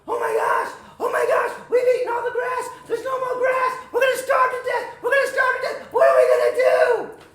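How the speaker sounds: high, loud, rapid-fire panic; hammed-up shrill-pitched frantic outburst; panicked goat. Little goat running between his brothers, panicking loudly. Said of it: "He started to panic".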